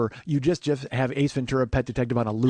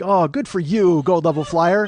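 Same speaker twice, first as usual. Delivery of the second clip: doting praise; 3 semitones higher, 6 dB louder, slower than usual. Retold or imitated. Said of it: "she'll be like".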